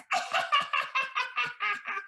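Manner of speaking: high witchy cackle; witch cackle. Acted. Witch cackling after cursing the prince. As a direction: cackle with evil glee.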